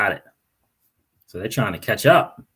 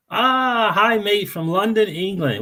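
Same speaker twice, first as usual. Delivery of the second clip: put-on Cockney accent; 7 semitones higher, slower than usual. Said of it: "What's up, Cockney Bill?".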